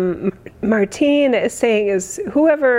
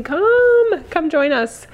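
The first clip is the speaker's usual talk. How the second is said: excited invitation; 8 semitones higher, much slower than usual. Real, not acted. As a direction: call them in eagerly. Inviting fans to her event.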